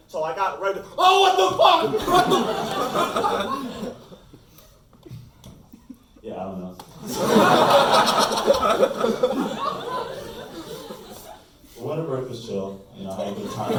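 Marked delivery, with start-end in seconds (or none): 1.0-4.0 very high, loud laughing outburst; laughing exclamation. Real, not acted. Comedian losing it at his own story, laughing high. 6.8-11.3 long, high, breathy laughing fit. Real, not acted. Comedian giggling on stage, long high laugh.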